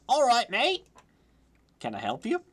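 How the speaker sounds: lightly attempted Cockney accent. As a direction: open loud and high in rough Cockney. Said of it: "I must do Cockney".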